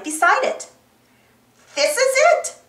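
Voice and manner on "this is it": very high, excited cartoon voice; exclaiming. A narrator's reading. Monkey arrives at Circle Lake, exclaiming. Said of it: "Boots exclaimed".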